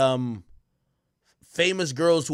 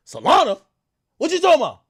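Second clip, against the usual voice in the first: high, loud disbelieving yelp; shocked what-do-you-mean; incredulous; 11 semitones higher, 9 dB louder than usual. Retold or imitated. Streamer retelling his confused reply to [name], high and loud.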